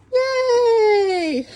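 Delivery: high, loud happy yay; excited cheer. Real, not acted. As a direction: squeal with delight at the goats. Entering the goat barn.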